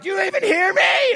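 high exasperated shout; parent yelling. Acted. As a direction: yell at a kid who is tuned out. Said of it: "You ever been yelling at a kid".

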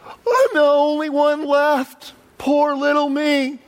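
high, slow, whining self-pity; whiny Elijah. Retold or imitated. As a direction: whine it like a sulking prophet. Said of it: "he whines to God".

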